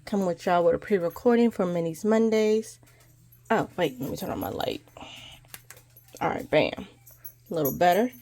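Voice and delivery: ordinary delivery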